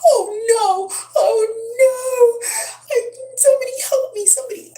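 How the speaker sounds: imitating a terrified little boy wailing; frantic high-pitched cry for help; panicked crying child. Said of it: "first he's like".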